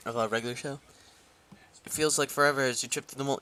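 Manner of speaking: usual speaking voice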